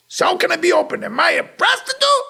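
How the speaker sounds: high, loud offended question; mock her offended protest; indignant retort. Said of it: "friend's indignant retort".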